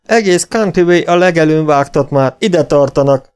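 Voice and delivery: high, loud alarm; shouted warning. A narrator's reading. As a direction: shout that danger is coming. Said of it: "kiáltotta".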